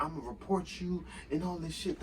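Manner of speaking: high, soft mock threat; mocking the manager. Pissed manager threatens to report the worker, mocked. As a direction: imitate a huffy boss in a small, high voice.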